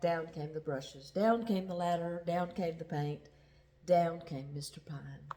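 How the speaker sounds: ordinary delivery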